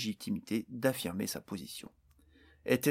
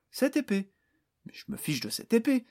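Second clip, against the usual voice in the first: much higher incredulous scoff, breaking to a whisper; scoffing disbelief; 12 semitones higher, 4 dB louder than usual. A narrator's reading. Barnes dismissing the orc's obsession, incredulous scoff. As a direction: wave the sword away with contempt.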